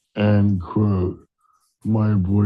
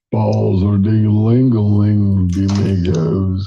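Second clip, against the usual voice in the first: loud sing-song; 8 dB louder than usual. Real, not acted. Caller singing a joke.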